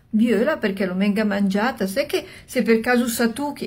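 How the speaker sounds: plain reading voice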